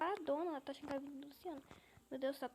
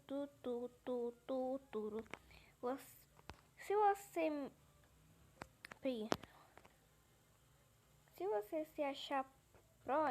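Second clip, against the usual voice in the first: ordinary talk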